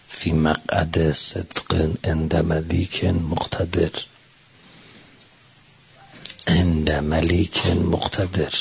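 slow, low chant-like recitation of the verse; Quranic recitation. Acted. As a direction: intone the Arabic slowly, reverent. (تلاوت آرام و بم آیه)

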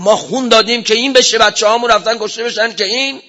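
high, loud angry cry; shouting. Retold or imitated. Martyr's mother shouting at the cleric, loud and high. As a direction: yell it at him like a grieving mother. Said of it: "داد زد سرم".

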